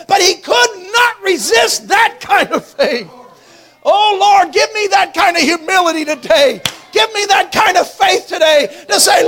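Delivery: loud, impassioned revival preaching; full-force preaching. Real, not acted. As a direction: preach it at a shout, driving every phrase home.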